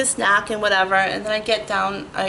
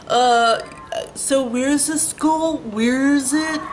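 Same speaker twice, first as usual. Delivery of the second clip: higher, slow, dopey; mock clueless cabbie; 5 semitones higher, slower than usual. Mocking a cab driver who pretends not to know the school. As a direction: play dumb like a scamming driver. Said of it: "they act like".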